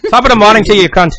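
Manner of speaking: put-on cheeky Irish greeting, Irish accent; hammed-up Irish brogue; mock Irish accent. Mocking Irish greeting after Irish talk.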